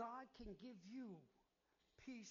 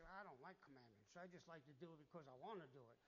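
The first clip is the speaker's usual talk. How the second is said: low, quieter, sulky complaining; grumbling churchgoer; 6 semitones lower, 6 dB quieter than usual. Retold or imitated. Pastor mocks a churchgoer who dislikes commandments, low and sulky. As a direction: mutter it like a reluctant believer. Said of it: "And you say".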